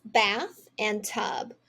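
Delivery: "bath and tub" said slowly, word by word; slow and clear. Shown as a demonstration. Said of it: "Here we have the two words".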